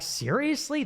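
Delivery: high mock disbelief; retold incredulous question, pitched high. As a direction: throw it up in disbelief. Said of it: "I'm like".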